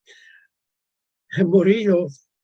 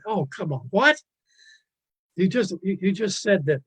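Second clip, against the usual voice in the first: mock disbelief; 5 semitones higher than usual. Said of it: "You say".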